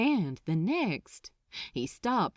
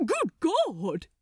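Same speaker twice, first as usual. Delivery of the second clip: high, loud startled cry; sudden shocked outburst; shocked exclamation; 8 semitones higher, 4 dB louder than usual. A narrator's reading. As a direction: gasp it out in shock. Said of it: "he exclaimed in shock".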